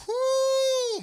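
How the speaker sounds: loud high whoo shout; excited whoop. Real, not acted. Commentator whoops as Moreno lands a big left. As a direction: let out a thrilled whoop as the punch lands.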